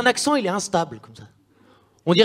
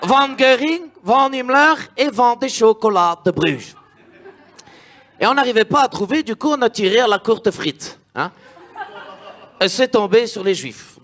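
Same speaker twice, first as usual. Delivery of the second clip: put-on speechmaking dictator with a Belgian accent; 2 semitones higher than usual. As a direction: play a pompous Hitler with a Belgian accent. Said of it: "toi, Hitler belge?".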